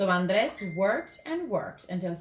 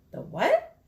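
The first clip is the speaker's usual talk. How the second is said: put-on high, baffled young boy asking; a kid who has never heard the word; puzzled kid; 10 semitones higher, 4 dB louder than usual. Andres confused by Gino's big word, high and puzzled. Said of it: "asked Andres".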